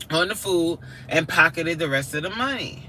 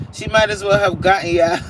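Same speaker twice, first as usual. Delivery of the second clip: ordinary delivery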